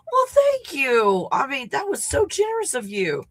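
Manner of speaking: high-pitched, heartfelt thanks; overwhelmed gratitude. Real, not acted. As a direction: thank a friend for a stunning favour. Thanking Ken for cabin.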